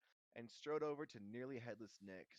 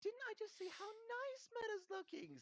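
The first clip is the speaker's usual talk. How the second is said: loud, very high forced sincerity; insistent cover-up; 5 dB louder than usual. A narrator's reading. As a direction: protest loudly in a high, anxious girl's voice. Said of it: "said Hermione, nudging Harry and Ron".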